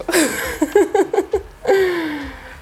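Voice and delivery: bright burst of real laughter. Real, not acted. Laughing at her joke about a new car.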